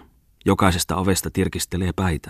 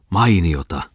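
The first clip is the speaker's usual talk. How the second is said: ordinary delivery, nothing marked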